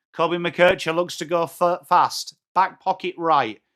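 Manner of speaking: usual speaking voice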